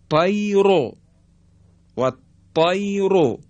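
طیرو with a thick, full ر; heavy R. Shown as a demonstration. Teacher shows the heavy ر on a vowel. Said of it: "حرف را مضمومه و در حقیقت تفخیم".

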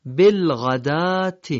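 بالغدات drawn out slowly and loudly, long open final a; teacher stretches بالغدات slowly and loudly; correct pronunciation. Shown as a demonstration. Teacher models بالغدات for Quran learners, slow and emphatic. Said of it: "این اشتباه شایعه".

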